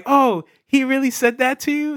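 lightly voiced MJ, high and charmed; mock MJ. Reviewer lightly voicing MJ, charmed, in a high voice. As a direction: ask it like a charmed girl. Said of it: "And she's like".

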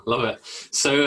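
usual speaking voice

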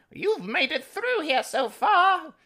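very high, slow squeaky voice; high villain voice. Acted. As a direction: squeak it out slowly. Voicing a villain greeting.

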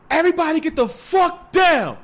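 loud, high raid command; imitated police shout. Storyteller acting the cops pulling up, imitated shout. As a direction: belt it out like cops bursting in.

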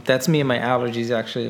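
usual speaking voice

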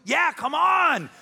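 high, loud, drawn-out holler; acted boisterous shout; rowdy welcome. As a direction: whoop them in to the party. Party guests cheering the invitation.

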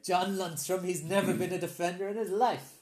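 high, loud 1920s newspaper editor. Retold or imitated. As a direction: bark it like an old-time editor. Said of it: "I sound like a newspaper editor from the nineteen twenties".

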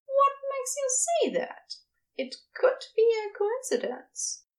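high, lilting, curious question; Ezra, innocently questioning. A narrator's reading. Ezra questions Crowley's suspicion of a set-up, innocently.

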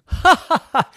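loud, high mocking ha-ha; burst of laughter. Planet bursts out laughing at Pudding's name, mocking laugh. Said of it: "just burst out laughing".

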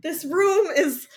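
high, loud exasperation breaking into laughter; exasperated disbelief. Retold or imitated. Podcaster objecting that the dance is tiny, exasperated disbelief. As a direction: hammer home the absurdity, ending in a laugh.

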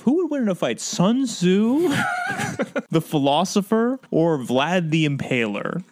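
high hyped trailer voice; dramatic TV announcer. Retold or imitated. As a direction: sell it like a cheesy show promo. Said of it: "Episodes like".

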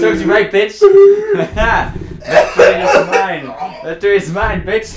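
loud laughing gloat. Acted. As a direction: gloat slowly, triumphant. Gloating after prank.

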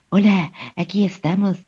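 a light mock of a breathy, angelic Castilian dub; lightly imitating an overdone Spain dubbing actor; Spanish dubbing voice. Mocking Spanish dubbing to podcast friends, light angelic storyteller voice. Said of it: "como una voz orgasmo angelical".

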